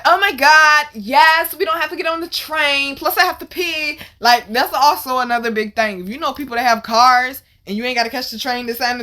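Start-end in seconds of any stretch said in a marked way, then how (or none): 0.0-4.0 high, loud excited squeal of relief; overjoyed. Retold or imitated. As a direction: gush like thrilled friends getting good news. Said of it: "They was so happy".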